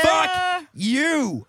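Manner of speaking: hammed-up defiant shouting; mock angry taunt. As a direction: yell it as a rowdy insult. Mock rant at label man.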